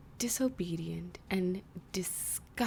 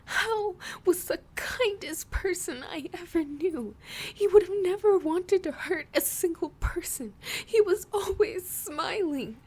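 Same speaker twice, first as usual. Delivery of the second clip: high, loud tearful wail; crying through words; 11 semitones higher, 5 dB louder than usual. Acted. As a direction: sob these words out, pitched high. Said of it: "continued crying through the words".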